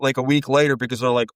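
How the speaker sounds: normal talking voice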